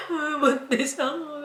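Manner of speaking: teary high voice, half laughing; crying while laughing. Retold or imitated. Friend mocking her crying confession, laughing tearfully. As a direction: laugh through the tears. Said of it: "nag-screenshot pa siya sa akin na umiiyak ako".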